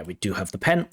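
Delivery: usual speaking voice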